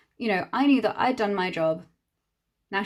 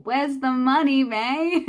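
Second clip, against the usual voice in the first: slow, louder pointed demand; 5 semitones higher, 4 dB louder, slower than usual. Retold or imitated. Confronting May over unpaid work, slow firm demand. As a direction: lean on each word to corner her. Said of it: "So I just said".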